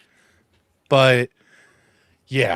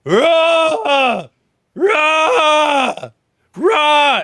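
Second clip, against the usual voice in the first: loud, high mimicked Goro roar; three drawn-out roars mocking a movie monster; 14 semitones higher, 9 dB louder, much slower than usual. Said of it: "he roars before Johnny Cage hits him".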